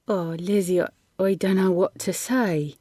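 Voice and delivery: put-on softly moved, rural accent thickening; hushed boy with a broader accent. A narrator's reading. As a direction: let the country accent thicken in quiet awe. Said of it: "he said softly, his accent thickening".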